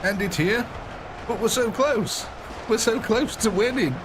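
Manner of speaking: excited. Real, not acted. Excited near-win in game.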